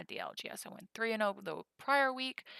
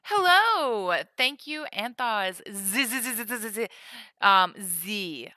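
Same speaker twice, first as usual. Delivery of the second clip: put-on drunk French chef; 12 dB louder than usual. Streamer thanking a viewer, loud mock French accent. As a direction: greet chat as a tipsy French chef. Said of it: "I'm sounding like a drunk Frenchman's chef today".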